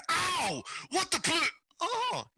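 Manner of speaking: high-pitched yelp breaking off; yelp, then catch yourself; startled yelp. Acted. Husband gets hit then sees his wife, startled yelp.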